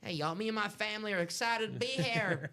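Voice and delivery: mock a Southern coach's twang at a press conference; fake Southern accent. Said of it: "You gotta fake a Southern accent".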